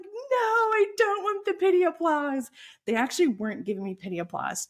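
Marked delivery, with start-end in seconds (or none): 0.0-2.5 high-pitched mock protest, much higher. Speaker protesting pity applause in her head, high mock plea. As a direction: insist you don't want pity, squeaky and pleading. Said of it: "And I'm like".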